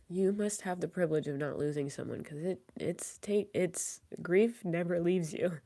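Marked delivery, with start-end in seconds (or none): none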